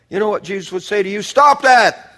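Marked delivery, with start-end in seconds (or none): 1.2-2.1 sudden high, loud command; sharp shouted rebuke. Acted. Preacher voicing Jesus to fearful Christians, shouted rebuke. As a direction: burst out with a scolding order.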